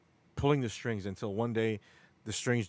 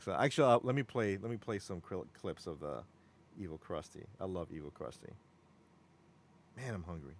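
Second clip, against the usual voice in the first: normal talking voice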